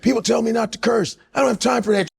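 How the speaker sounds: high, shouted defiance; David Goggins impression. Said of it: "He said".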